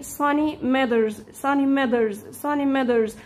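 "سانيمترز" with the n-t sound dropped, slow; teacher drilling "سانيمترز" for beginners. Shown as a demonstration. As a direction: drill "سانيمترز" slowly, no t after the n. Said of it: "هنلغيها شويه في النطق".

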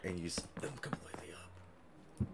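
unvoiced whisper. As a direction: whisper the rest. Joking instruction.

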